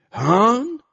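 high, rising outraged shout; angry roar. A narrator's reading. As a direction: bellow it as an indignant question. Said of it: "با صدایی دوچندان بلند و دوچندان غضبناک دوباره نعره کشید". (نعرهٔ غضبناک با صدای زیر)

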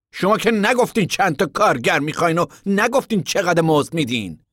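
angry. A narrator's reading. Floyd angrily calling out the contractor on the wages. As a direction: Floyd angrily pressing him. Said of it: "فلوید خشمناک گفت".

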